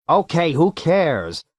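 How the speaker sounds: a touch of irritation, dragged out; irritable. A narrator's reading. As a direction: brush it off with irritation. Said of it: "Leo said irritably".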